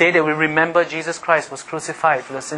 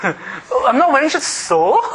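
defiant laughing; 9 semitones higher, slower than usual. Retold or imitated. Acting defiant worker, laughing.

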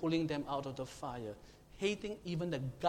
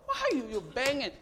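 screaming high and much louder; panicked shout; 10 semitones higher, 9 dB louder, slower than usual. Shown as a demonstration. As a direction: yell to wake sleepers in a fire. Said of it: "shouting and screaming, won't you?".

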